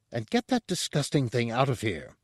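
restrained, disgusted demand. A narrator's reading. Chef tells the guard to remove a corpse, demanding. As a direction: order it out without shouting. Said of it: "Porcellus demanded".

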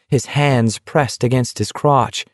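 ordinary delivery, nothing marked

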